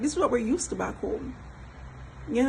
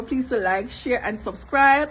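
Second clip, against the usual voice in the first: usual speaking voice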